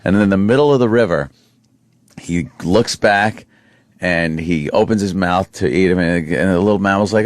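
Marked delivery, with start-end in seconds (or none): none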